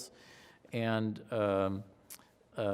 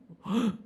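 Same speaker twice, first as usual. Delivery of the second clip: retold gasp; 11 semitones higher, 4 dB louder than usual. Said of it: "I'm gasping for air".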